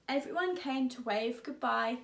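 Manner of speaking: ordinary delivery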